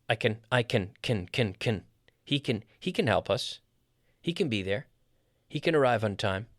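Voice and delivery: CAN as a weak, short "kn"; reduced CAN. Shown as a demonstration. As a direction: slide over CAN onto the next word. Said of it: "we don't normally stress the affirmative".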